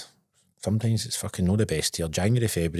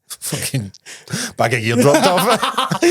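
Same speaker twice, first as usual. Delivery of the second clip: louder, higher and slow, laughing through it; 9 semitones higher, 4 dB louder, much slower than usual. Real, not acted. Telling his mate about the delivery, laughing. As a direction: crack up mid-sentence.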